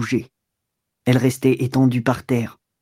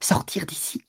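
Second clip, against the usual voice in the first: hushed, whispery and higher; stammered disbelief; 4 semitones higher, 9 dB quieter than usual. A narrator's reading. Woman threatened with being thrown out, stammering in a whisper. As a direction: breathe it out in stunned disbelief. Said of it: "Elle balbutia".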